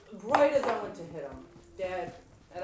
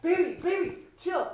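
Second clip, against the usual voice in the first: higher, louder pleading to calm down; husband calming her; 9 semitones higher, 7 dB louder than usual. Retold or imitated. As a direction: imitate a husband begging her to chill. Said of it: "My husband Sam's like".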